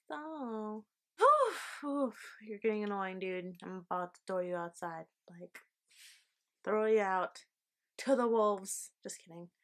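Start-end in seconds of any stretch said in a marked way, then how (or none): 1.2-2.4 sharp pained oof, then a smaller one; strained grunt. Real, not acted. Dog getting annoying. 6.6-8.9 loud, playful scolding of the dog; mock threat.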